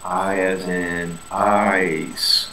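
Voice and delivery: I as in ice, drawn out very slowly; teaching the long vowel I slowly; slow vowel demo. Shown as a demonstration. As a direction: stretch the I in ice. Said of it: "my second set of vowels".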